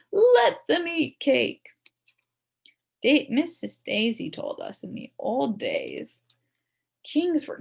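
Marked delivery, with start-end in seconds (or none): 0.1-1.7 loud, slow royal proclamation; mock king. A narrator's reading. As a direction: declare it grandly from the throne. Principal playing king.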